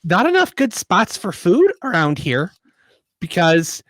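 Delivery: lightly voiced chirpy halfling; a hint of a peppy little halfling. Halfling character voice.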